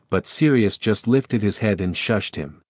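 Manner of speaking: usual speaking voice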